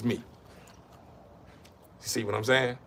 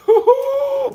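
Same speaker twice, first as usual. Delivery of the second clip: high laugh; 4 dB louder than usual. Real, not acted. Laughs at tough father.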